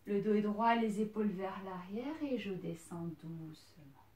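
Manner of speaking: usual speaking voice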